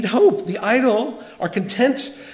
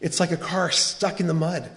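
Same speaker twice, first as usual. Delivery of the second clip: normal talking voice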